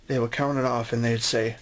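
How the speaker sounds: ordinary talk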